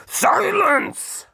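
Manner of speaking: very high, loud, held roar. A narrator's reading. The Duke roars silence at Hark, very high and loud. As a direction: roar the word to end it. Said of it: "roared the Duke".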